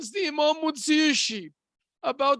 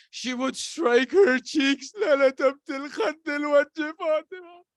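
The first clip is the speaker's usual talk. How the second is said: mourning lament; 2 semitones higher than usual. A narrator's reading. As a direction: recite it as a wailing lament. Mourning verse of Fatima's grief.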